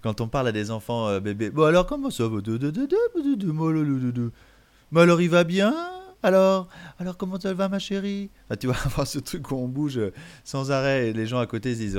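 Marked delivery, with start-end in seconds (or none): 1.5-4.3 put-on high, louder singsong to a baby; baby talk. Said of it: "ce langage un peu débile". 4.9-8.3 put-on high, louder singsong to a baby; baby talk. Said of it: "ce langage un peu débile".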